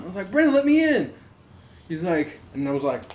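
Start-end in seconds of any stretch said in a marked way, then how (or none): 0.3-1.1 higher, exasperated retold call; insistent calling out. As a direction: call through a locked door, pitched up. Knocks again, calling out to roommate.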